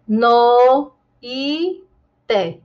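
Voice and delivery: "no e te" in separate drawn-out syllables; split diphthong. Shown as a demonstration. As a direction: break noite apart as "no e te". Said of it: "eu não posso fazer assim".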